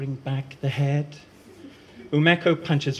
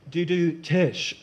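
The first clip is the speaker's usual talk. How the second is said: 1 mouth rimshot; 5 semitones higher, slower than usual. Acted. Ash mimics a rimshot slowly.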